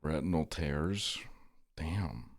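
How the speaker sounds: soft unvoiced whisper reading chat; hushed whisper, slow on the first words. Real, not acted. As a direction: breathe the words out with no voice. Reading chat message aloud.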